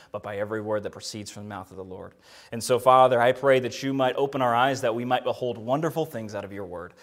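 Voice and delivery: quiet, earnest prayer voice. Acted. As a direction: pray it softly and sincerely. Opening prayer.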